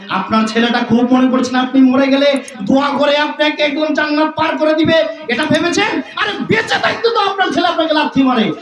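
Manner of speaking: high, ringing sermon cry; sustained high-pitched preacher's proclamation; full-force preaching. Real, not acted. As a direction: drive the point home to the congregation.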